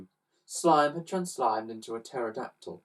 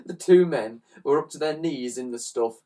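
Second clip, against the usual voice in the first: ordinary delivery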